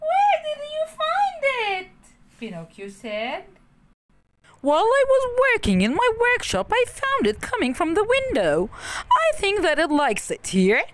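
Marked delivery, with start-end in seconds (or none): 0.1-1.9 high, loud delighted puppet; excited shout. A narrator's reading. Surprise at cuckoo. 4.6-10.8 put-on excited old man. A narrator's reading. Geppetto voiced in story.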